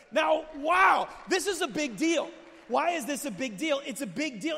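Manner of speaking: shouting in amazement; shouted exclaim. Real, not acted. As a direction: cry out that it's a huge deal. Exclaiming at the climax.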